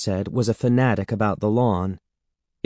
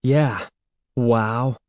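dry, unimpressed repeat; deadpan mock agreement; sarcastic echo. A narrator's reading. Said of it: "he repeated sarcastically".

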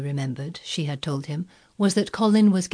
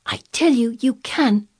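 high, insistent urging; to herself; 7 semitones higher, 6 dB louder than usual. A narrator's reading. Mary willing Colin on, insistent. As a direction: insist with rising intensity. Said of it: "said Mary to herself".

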